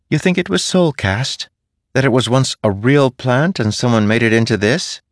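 a cheeky little spren musing; playful spren voice. A narrator's reading.

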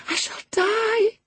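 very high, slow, anguished wail; shriek. A narrator's reading. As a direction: wail it with full voice. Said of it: "she shrieked".

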